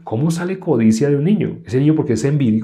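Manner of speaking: usual speaking voice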